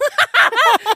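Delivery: high loud laughing burst. Real, not acted. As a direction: crack up laughing.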